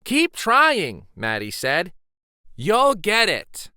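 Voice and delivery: encouraging call. Acted. Maddie encourages fallen friend.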